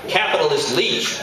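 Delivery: high-pitched cry of accusation; accusing cry. Acted. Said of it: "cried the duck".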